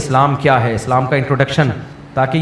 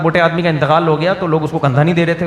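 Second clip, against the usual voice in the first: usual speaking voice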